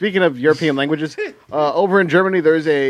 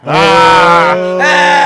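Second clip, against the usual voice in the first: loud shout into a laugh; surprised shout and laugh; 4 semitones higher, 9 dB louder than usual. Real, not acted. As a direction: burst out at the joke. Sudden loud reaction to joke.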